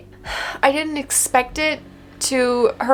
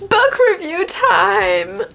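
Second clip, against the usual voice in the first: slow, louder, higher announcement; announcer cheer; 6 semitones higher, 3 dB louder, much slower than usual. Real, not acted. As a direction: call it out like a show host. Announcing segment.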